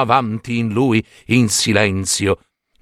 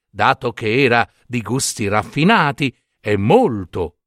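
ordinary delivery

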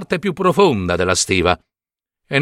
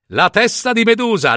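mocking repeat; 7 semitones higher than usual. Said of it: "scoppiò in una risata".

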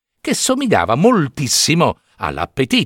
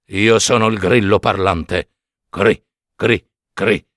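put-on low, old cricket voice with chirps; talking cricket; 3 semitones lower than usual. The talking cricket greets Pinocchio in a low voice.

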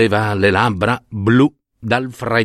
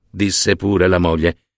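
usual speaking voice

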